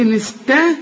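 "إن استطعتم" broken into syllables, light "است"; light s, heavy ط. Shown as a demonstration. Teacher dictating to a student, light s then heavy ط. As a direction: sound out "استطعتم", thickening only the ط. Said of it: "يبقى رقاق للسين".

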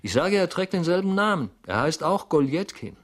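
loud, high, insistent; emphatic repetition. A narrator's reading. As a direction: spell it out loudly to the disbelieving. Repeats startling news to incredulous listener.

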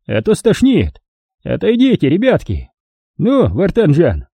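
put-on high, gloating old man voice; a cruel old miner mocking his victim; old prospector. A narrator's reading.

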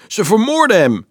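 high, loud cry of alarm; alarmed shriek. A narrator's reading. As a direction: shriek it in horror for someone else. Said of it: "gilde Marieke". (gillende noodkreet)

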